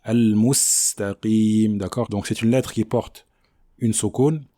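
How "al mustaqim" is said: "al-mustaqim" with a held, whistling S; clear Sin. Shown as a demonstration. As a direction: linger on the S of "al-mustaqim". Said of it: "Il faut bien prononcer le sin".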